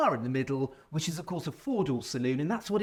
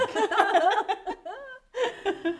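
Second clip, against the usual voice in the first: high laugh. Real, not acted. Laughs at own joke.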